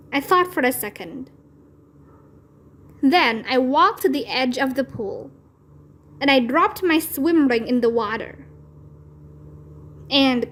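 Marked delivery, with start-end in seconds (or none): none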